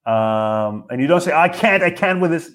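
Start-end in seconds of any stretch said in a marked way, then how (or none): 1.3-2.5 high, exasperated protest; agitated, raised-pitch refusal; worked-up refusal. Shown as a demonstration. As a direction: blurt it out overwhelmed and flustered. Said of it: "don't get excited".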